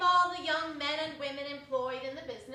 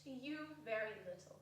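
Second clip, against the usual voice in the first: soft; 3 semitones lower, 9 dB quieter, faster than usual. Acted. Girl continuing softly.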